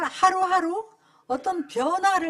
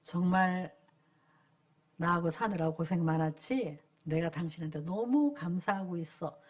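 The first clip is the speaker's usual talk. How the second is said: tender praise to wife; 8 semitones lower, 6 dB quieter than usual. Shown as a demonstration. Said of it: "말을 해야 돼".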